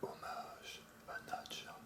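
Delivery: slow, very soft whisper. Acted. Whispering a homage to Nadja, in a slow whisper. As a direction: breathe the dedication in a slow whisper. Whispered dedication before the poem.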